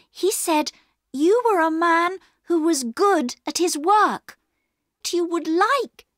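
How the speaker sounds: put-on high child's voice, urgently explaining; high-pitched, insistent young girl; earnest pleading girl. A narrator's reading. Plays Bobby pleading with Perks.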